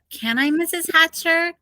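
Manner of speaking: usual speaking voice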